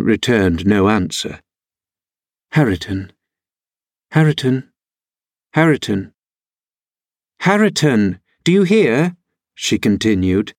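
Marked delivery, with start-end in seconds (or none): 2.5-9.2 calling his name, each try more pressing; name repeated with pauses, rising insistence; insistent calling. Acted. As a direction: keep calling him, climbing higher toward an impatient question. Calling a cousin who ignores her.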